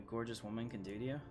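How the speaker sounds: ordinary talk, nothing marked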